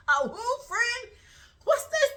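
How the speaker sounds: high, indignant disbelief; incredulous. Retold or imitated. Girlfriend questioning boyfriend's friendship ring, incredulous. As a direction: question it in high-pitched outrage.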